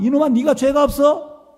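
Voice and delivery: high, loud scolding shout; thundering rebuke. Retold or imitated. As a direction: thunder at the boy. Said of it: "목사님 막 강하게 막".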